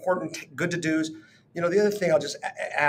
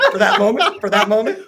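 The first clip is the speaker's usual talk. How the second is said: laughing; 6 semitones higher, 9 dB louder than usual. Real, not acted. Teasing co-host's voice.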